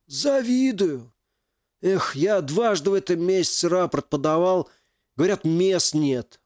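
put-on young veteran voice. Legless veteran speaks.

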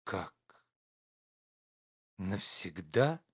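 slow, stunned question; astonished. A narrator's reading. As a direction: disbelief at the news. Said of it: "с изумлением спросил Штольц". (изумлённо)

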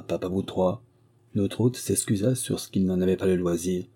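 plain reading voice

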